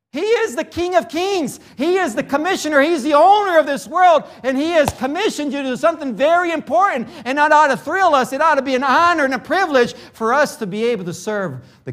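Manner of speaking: high, ringing pulpit declaration; fervent preaching. Real, not acted. Preaches at full force.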